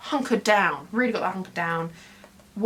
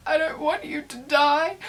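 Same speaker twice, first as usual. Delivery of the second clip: higher, drawn-out mock wail; retold crying; 6 semitones higher, slower than usual. Telling how she cried at her partner's question, retold crying. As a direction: wail it slowly, imitating tearful protest. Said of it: "I just started crying".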